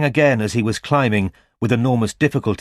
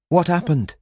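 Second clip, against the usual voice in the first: higher, pressing female voice; concerned woman voice; 8 semitones higher than usual. A narrator's reading. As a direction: Robin asking again. Robin voiced.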